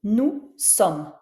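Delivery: "Nous sommes" said nou-somme, the S of "Nous" silent; no liaison in nous sommes. Shown as a demonstration. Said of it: "je prononce pas le S de nous".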